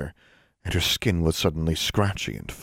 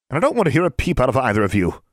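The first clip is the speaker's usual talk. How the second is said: higher, quicker scolding; stern warning, raised pitch and quick pace; 6 semitones higher, 4 dB louder, faster than usual. A narrator's reading. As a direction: tell off two pupils briskly. Secretary scolds two students.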